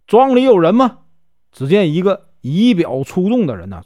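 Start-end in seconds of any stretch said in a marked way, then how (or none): 0.1-1.0 raised voice calling into the empty courtyard; calling out loudly. A narrator's reading. Zhu Tong calls into the manor courtyard, loud call. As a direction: call out to whoever is inside, loud and high. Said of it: "就大声问".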